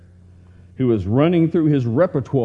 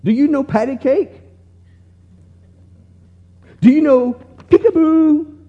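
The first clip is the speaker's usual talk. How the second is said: put-on high, loud drunken hollering across a room; loud, high sing-song drunk call; drunken yell; 6 dB louder than usual. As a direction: yell it out like a tipsy old man calling to a baby. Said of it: "He yelled across the restaurant".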